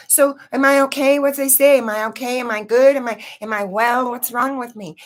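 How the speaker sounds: put-on a scared kid begging to know; anxious child. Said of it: "and I said".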